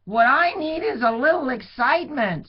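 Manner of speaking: put-on high, loud, longing boy; eager shepherd boy. A narrator's reading. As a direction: beg for excitement. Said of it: "said the shepherd".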